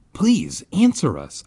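high, urgent plea; begging. A narrator's reading. Narrator to silent circle, begging. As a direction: beg them to respond. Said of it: "I begged".

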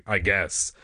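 plain reading voice

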